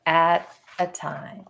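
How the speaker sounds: ordinary delivery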